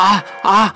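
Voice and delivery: high pained groans; sharp, agonized "aah"; cries of pain. Acted. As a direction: cry out twice in pain. Victim's pain returns.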